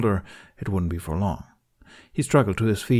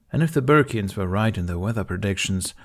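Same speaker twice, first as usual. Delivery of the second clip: plain reading voice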